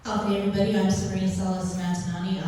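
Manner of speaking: ordinary talk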